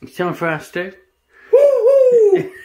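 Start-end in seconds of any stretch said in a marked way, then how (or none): 1.5-2.5 high, loud celebratory cheer; triumphant woo-hoo after finishing the job; excited whoop. Real, not acted. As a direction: whoop it out for the camera.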